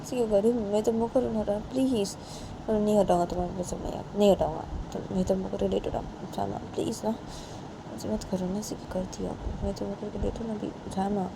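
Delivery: quiet please-don't-be-mad plea; soft pleading. Acted. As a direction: coax her to turn around. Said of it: "तो बानी बोलती है".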